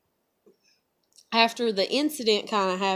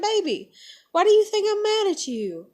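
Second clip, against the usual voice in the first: tender; 11 semitones higher, 5 dB louder than usual. Pretend. Imagined tender words to her.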